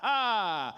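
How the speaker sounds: loud, haughty mock laugh; hammed-up gloating "ha ha ha"; smug British laugh. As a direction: laugh grandly in someone's face, put on. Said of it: "I became quite smug and British".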